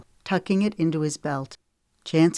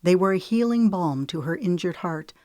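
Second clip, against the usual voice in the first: plain reading voice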